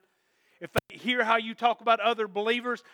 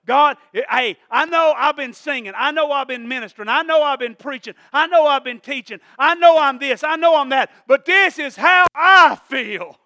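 raised-voice plea to God, building louder; impassioned outpouring; 3 semitones higher, 7 dB louder than usual. Acted. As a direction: dump it all out to God, slowing hard on the last line. Acted frank outburst to God in prayer.

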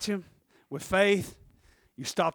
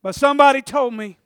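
loud, higher, insistent declaration; emphatic repeat; 7 semitones higher, 12 dB louder than usual. Retold or imitated. Preacher voicing the woman justifying her touch, emphatic repeat. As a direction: press it home with raised voice.

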